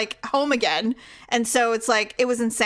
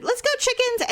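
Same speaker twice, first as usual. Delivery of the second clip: high singsong let's go, chickens; breezy lilt like herding chicks; mocking husband; 13 semitones higher than usual. Mocking Daryl herding the chickens home, high singsong. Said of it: "like, went".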